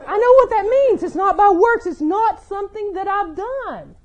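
high, loud, laughing joy; joyful breakthrough. Retold or imitated. Bridget telling herself what grace means, laughing and loud. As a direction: laugh it out as the truth dawns.

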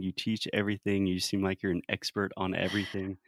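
ordinary talk, nothing marked

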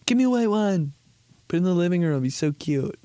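mock cutesy mom. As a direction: coo it like a delighted mom. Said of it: "they, the moms".

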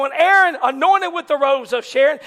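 ordinary talk, nothing marked

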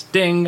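high, short bell noise; imitated ding. Lie detector confirms the boss's answer, high imitated ding. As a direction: chime like the machine. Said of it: "And it goes".

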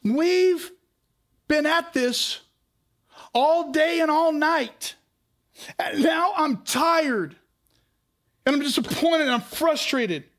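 high, slow, crying-edged complaint; weary lament. Retold or imitated. Peter telling Jesus he's worn out, slow and pained. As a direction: drag out the exhaustion. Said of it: "He says".

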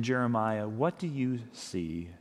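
slow, hushed voice of God asking; reverent reading. Shown as a demonstration. Pastor reads God's question to Jeremiah, slow and hushed. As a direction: read it softly and slowly, letting the question hang. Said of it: "scripture reading, God's question".

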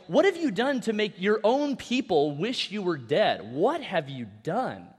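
ordinary delivery

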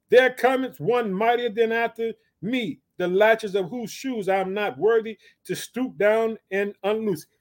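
slow, stretched-out verse reading; scripture declamation. Acted. Preacher reads John's words to the congregation, slow declamation. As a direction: draw out each phrase as you proclaim it.

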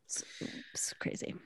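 breathless hushed aside; awed whisper. Real, not acted. Podcast cohost whispering about the final episode, awed whisper. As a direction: whisper it in disbelief.

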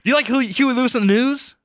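high, loud yuppie voice; loud, high smug yuppie question; American Psycho. Retold or imitated. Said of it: "the American Psycho".

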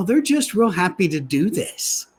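normal talking voice

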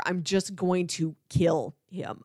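ordinary talk, nothing marked

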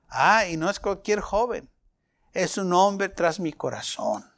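ordinary talk, nothing marked

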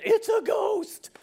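high, loud, stretched-out alarm; mock terrified shout; frightened cry. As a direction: shriek it like a spooked onlooker. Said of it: "in their fear they cried out".

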